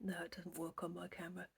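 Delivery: fast hushed aside; quick whisper. Real, not acted. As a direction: mutter it quickly under breath.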